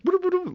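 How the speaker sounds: very high-pitched nonsense babble; imitated guide's noise. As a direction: mimic the guide's quick little sounds. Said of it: "he's just like".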